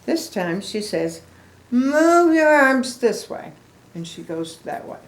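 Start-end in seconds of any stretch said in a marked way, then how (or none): 1.7-3.5 higher, louder, slow instruction; coaching call. Acted. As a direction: call out the move like a dance teacher. Piggy instructing loudly.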